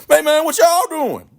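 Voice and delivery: hammed-up nosy interruption; mock nosy student. Nosy student butting in loudly.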